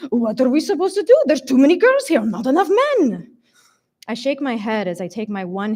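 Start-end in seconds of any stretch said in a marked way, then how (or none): 0.1-3.3 put-on high, loud Hungarian-accented complaint; thick Hungarian accent. A narrator's reading. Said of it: "Hungarian accent".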